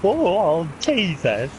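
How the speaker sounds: put-on high, slow, wobbly comic old-man voice; Ed Wynn impression. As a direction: stretch out Deborah in a silly high voice. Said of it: "Nice Ed Wynn".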